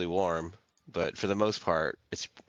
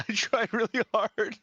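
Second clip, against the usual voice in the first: laughing; 13 semitones higher than usual. Real, not acted. Laughing about her yawn.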